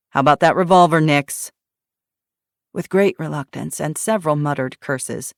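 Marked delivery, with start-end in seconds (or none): 0.1-1.5 demanding. Acted. Eli demands the revolver.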